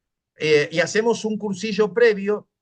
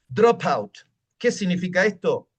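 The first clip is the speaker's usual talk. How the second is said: usual speaking voice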